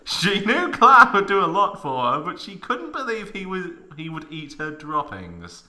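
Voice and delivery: ordinary talk, nothing marked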